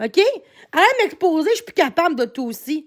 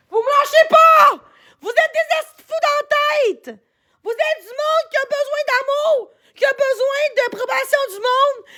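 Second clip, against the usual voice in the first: furious shout; 9 semitones higher than usual. Real, not acted. Streamer yelling at the chat harassing her, furious shout. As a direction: lose it completely at the people mocking you.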